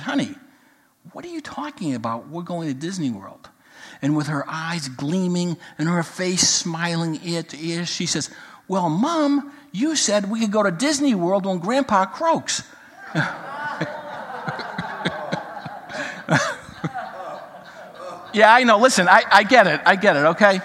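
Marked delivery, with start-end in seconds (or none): 0.0-3.5 much softer, calm mother; quiet her down gently; hushed mom. Retold or imitated. 8.7-12.6 put-on higher, innocent child voice; girl beaming at Mom; gleeful little girl. Girl's gleaming punchline. 13.1-16.6 soft breathy laugh; laughing softly; quiet laugh. Real, not acted. Laughter after punchline.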